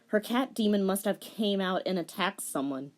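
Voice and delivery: ordinary delivery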